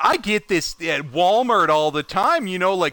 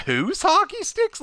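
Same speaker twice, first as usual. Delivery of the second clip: puzzled; 14 semitones higher than usual. Retold or imitated. Puzzled reply quoted.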